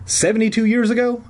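high, pressing question; insistent. A narrator's reading. Kid pushing the janitor about the past, insistent. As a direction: press for the answer. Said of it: "I insisted".

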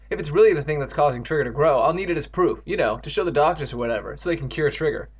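ordinary delivery, nothing marked

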